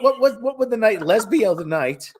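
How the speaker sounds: usual speaking voice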